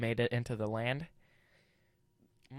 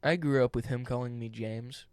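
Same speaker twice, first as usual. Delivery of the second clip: ordinary delivery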